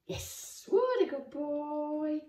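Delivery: slow, drawn-out puppy praise; dog praise voice. Real, not acted. Praising the dog after a trick.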